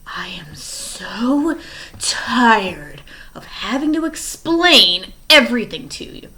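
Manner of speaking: slow, seething whisper; furious whisper. Acted. Stefano threatens Klaus, slow seething whisper. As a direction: hiss the threat through fury. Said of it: "eyes wide and red with fury".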